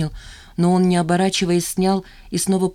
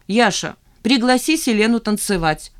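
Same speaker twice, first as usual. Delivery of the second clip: plain reading voice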